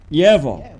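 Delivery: Yavo with a narrowed first vowel, nearer YEH-vo; between two vowels. Shown as a demonstration. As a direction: model Yavo carefully, dropping in pitch. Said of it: "Now between I and A".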